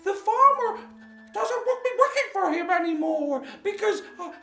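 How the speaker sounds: very high, halting squawky rooster; nervous cockerel character. Acted. Nervous cockerel explains his trouble, high squawking voice. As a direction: squawk it out anxiously, bursting into a crow. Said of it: "he was very nervous".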